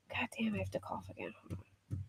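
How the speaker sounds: hushed whisper, no voice. Real, not acted. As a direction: whisper it off mic. Whispers an aside about coughing.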